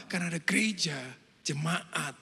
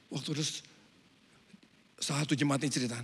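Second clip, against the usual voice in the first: usual speaking voice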